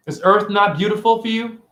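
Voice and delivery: normal talking voice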